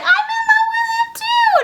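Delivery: very high lovestruck outburst; mock gushing squeal. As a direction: squeal the confession like a cartoon sweetheart. Elizabeth gushes her love.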